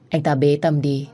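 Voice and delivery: ordinary delivery, nothing marked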